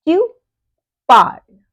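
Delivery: "Tu parles" with a gap, "parles" loud; repeat-after-me model. Shown as a demonstration. Teacher has learners repeat "you speak", model "Tu parles". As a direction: model "Tu parles", saying "parles" as "parl", silent es. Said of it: "Repeat with me".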